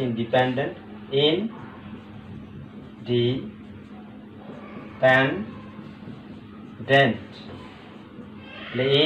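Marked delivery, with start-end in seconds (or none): 1.1-7.2 "independent" extremely slow, long silences between syllables. Shown as a demonstration. Teacher breaking the word up for students, very slowly. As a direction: leave a long pause after each syllable of "independent". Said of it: "খেয়াল করো".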